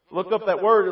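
usual speaking voice

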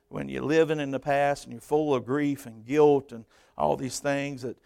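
normal talking voice

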